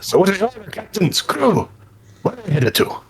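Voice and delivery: high-pitched boat character; inquisitive talking boat. Acted. Boat asks where the crew is headed, high inquisitive voice. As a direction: ask eagerly in a high cartoonish voice.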